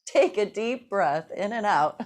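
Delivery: ordinary delivery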